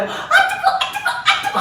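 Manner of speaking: high squealing ouch ouch; burning foxes crying. Acted. Foxes with burning tails squeal, high and frantic. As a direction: squeal like a fox with its tail on fire.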